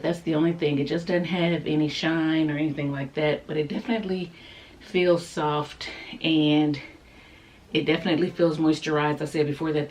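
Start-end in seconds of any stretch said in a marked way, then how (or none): none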